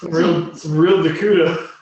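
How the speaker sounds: laughing. Real, not acted. Laughing at the roll.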